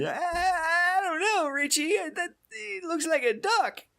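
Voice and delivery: very high, slow silly voice; high-pitched goofy impression; quoting a bit character. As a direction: squeak it out like a dopey side character. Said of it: "there's the guy that says".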